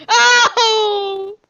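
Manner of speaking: loud, high yell on being hit; pained cry. Pretend. Player hit by the companion, pained cry. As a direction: cry out at a sudden blow.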